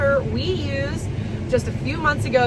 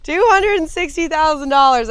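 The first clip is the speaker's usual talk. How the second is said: higher, louder, amused disbelief; barely straight-faced; 5 semitones higher, 6 dB louder than usual. Real, not acted. Vlogger repeating the corn head's price, barely straight-faced. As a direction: blurt it out, struggling to keep a straight face. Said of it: "can't even say that with a straight face".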